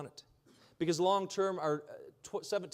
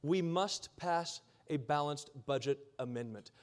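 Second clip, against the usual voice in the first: normal talking voice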